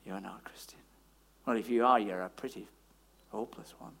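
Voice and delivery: whispered inner accuser, very low and soft; little accusing voice. Acted. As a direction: whisper the doubt into the listener's ear. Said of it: "this little voice comes and says".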